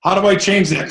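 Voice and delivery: ordinary talk, nothing marked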